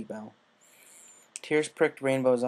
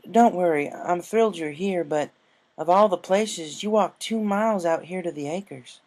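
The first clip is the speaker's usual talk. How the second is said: lightly voiced country mare; a hint of a friendly farm pony; Applejack voice; 7 semitones higher, 5 dB louder than usual.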